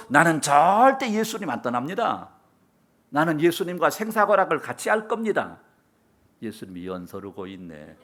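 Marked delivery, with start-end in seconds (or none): 0.1-5.6 Peter's confident declaration, voice raised; boastful vow. Acted. Peter boasting to Jesus he'll never leave: raised vow. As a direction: swear it loudly and proudly. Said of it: "큰 소리 꽝꽝 쳤던".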